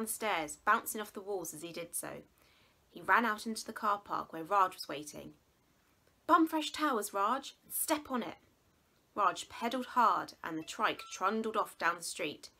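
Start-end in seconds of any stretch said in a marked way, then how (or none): 6.3-8.4 loud, high, urgent order to drive off; urgent shout. Acted. Boy shouts to his driver to hurry home, urgent loud call.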